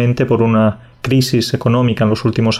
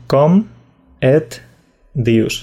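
"Com" "et" "dius?" spaced out with pauses; slow word by word; much slower than usual. Shown as a demonstration. Teacher spells out "Com et dius?" word by word. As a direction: break "Com et dius?" into single words. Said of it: "Sería algo así como".

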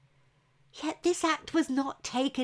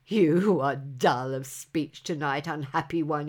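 a huge man mocking from above; rumbling giant; 6 semitones lower than usual. A narrator's reading. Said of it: "he rumbled".

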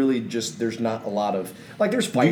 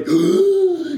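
excited gasp; 4 dB louder than usual. Retold or imitated.

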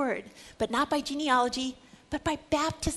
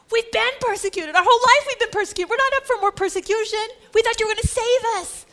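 exasperated crowd; 9 semitones higher, 7 dB louder, faster than usual. Acted. Crowd protesting more persecution.

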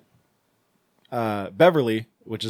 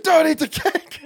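high-pitched hammed-up warning, laugh creeping in; mock panic; 13 semitones higher than usual. Joyce smacking cake from people's hands, mock-frantic warning. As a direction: play frantic alarm for laughs.

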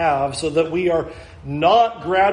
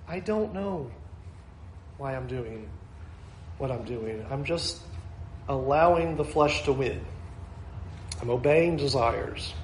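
defeated confession; 5 semitones lower, 9 dB quieter than usual. Retold or imitated. Enslaved person answers why.

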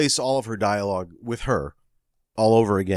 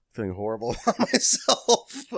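laughing; 5 semitones higher, 3 dB quieter than usual. Real, not acted. Laughing at own situation.

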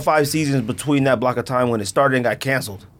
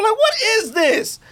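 mock shock; 13 semitones higher, slower than usual. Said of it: "I'm like".

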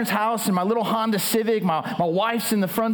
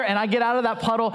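normal talking voice

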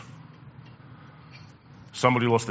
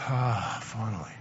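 soft relieved sigh; 3 semitones lower, 12 dB quieter than usual. Pretend. Relieved breath after gasp.

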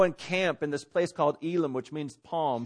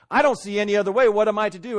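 higher, louder acted plea; faith crisis; 4 semitones higher, 6 dB louder, faster than usual. Believer in a faith crisis questioning God, acted desperation. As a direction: cry out as if out of options. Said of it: "it's a faith crisis saying".

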